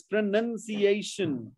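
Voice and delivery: PRONUNCIATION said slowly with "nun", not "noun"; correct pronunciation. Shown as a demonstration. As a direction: stretch out pro-NUN-ciation to correct it. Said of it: "not pronounce".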